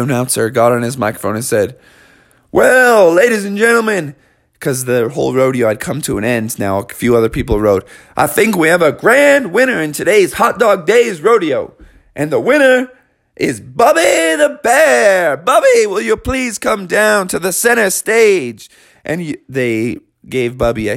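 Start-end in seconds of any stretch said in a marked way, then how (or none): 2.5-4.1 higher, twangy announcer call; rodeo announcer. Acted. Announcer on microphone. 8.2-18.7 higher, twangy announcer call; rodeo announcer. Acted. Announcer names winner.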